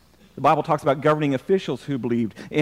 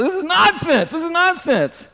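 high-pitched, indignant exclamation; emphatic outburst; 11 semitones higher than usual. Real, not acted. Preacher dismissing the idea of billions of crazy people, emphatic outburst.

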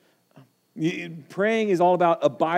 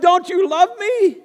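much higher and louder, desperate plea; crying out to God; 13 semitones higher, 6 dB louder than usual. Acted. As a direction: cry out to God in pain. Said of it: "you cry out to God".

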